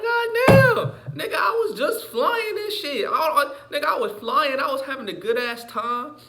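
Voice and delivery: high-pitched loud excitement; excited squeal. Retold or imitated. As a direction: squeal it out, thrilled. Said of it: "But it's like".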